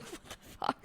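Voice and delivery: breathy unvoiced whisper, very soft; whispered what the fuck. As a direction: whisper it in disbelief. Quoting her own baffled reaction.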